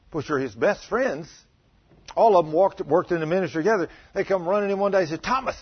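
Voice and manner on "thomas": high, loud, breathless announcement; excited news. Acted. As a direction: blurt it out like news too good to hold. Disciples bursting in with news.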